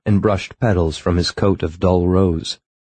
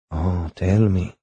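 slow coaxing; 3 dB quieter, slower than usual. Acted. Coaxing him to tell more.

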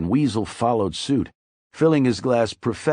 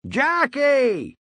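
bawled name. A narrator's reading. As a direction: yell for the waitress across the room, pitched way up. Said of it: "he bawled".

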